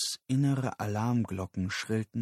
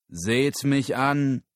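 slow, loud proclamation; calling out; 5 semitones higher, 6 dB louder, slower than usual. Acted. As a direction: call the whole crowd's attention, drawn out. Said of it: "rief er". (laut und gedehnt gerufen)

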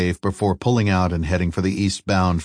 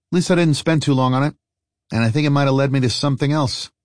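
higher, quick character voice; lightly voiced traveller; 7 semitones higher, faster than usual. Traveller telling friends about a failed lead, lightly voiced, higher and quick. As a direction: lift the pitch and move briskly, making the best of it. Story character's reply in dialogue.

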